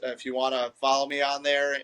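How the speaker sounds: normal talking voice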